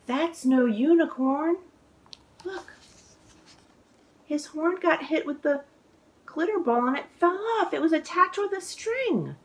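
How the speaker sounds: high, wide-eyed gasping discovery; surprised reveal. A narrator's reading. Author reading the twist to kids, surprised. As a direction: sound amazed by the reveal.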